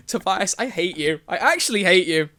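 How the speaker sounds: ordinary delivery, nothing marked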